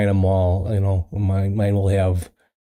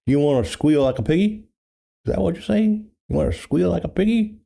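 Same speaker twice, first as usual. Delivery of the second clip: mock hillbilly voice, pitched up; hillbilly movie line; 8 semitones higher, faster than usual. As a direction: ham up a creepy backwoods taunt. Deliverance hillbilly quote joke.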